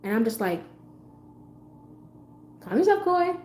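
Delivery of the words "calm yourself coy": high, sustained self-soothing voice; calming herself. Retold or imitated. Woman coaching herself not to snap back, high-pitched self-calming. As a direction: talk yourself down in a thin high pitch. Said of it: "so I'm calming myself".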